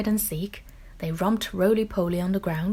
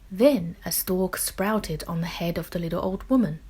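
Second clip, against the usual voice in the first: usual speaking voice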